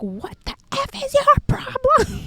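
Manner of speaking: sudden very high outraged retort; snapping back. Retold or imitated. To the classmate who mocked her, high outraged snap. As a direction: burst out at him in disbelief.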